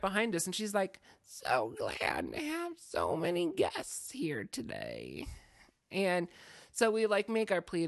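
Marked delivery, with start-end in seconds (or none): 1.2-5.3 slow, low, eerie witch voice; sinister hostess drawing out her welcome; Missus Bigley. Acted. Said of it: "Missus Bigley".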